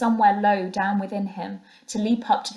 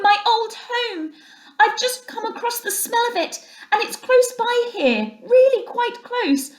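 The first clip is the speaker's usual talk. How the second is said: high, urgent pleading, slowing on the last please; painful appeal; 5 dB louder than usual. A narrator's reading. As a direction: beg desperately for him to come back. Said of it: "the sharp note of painful appeal in his voice".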